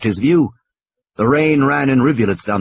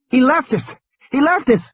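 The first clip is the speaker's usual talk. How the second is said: high, panicky repeated cry; frightened child; 13 semitones higher than usual. Acted. High, scared child voice. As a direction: Lex keeps crying out that they were left. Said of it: "she had started to scream".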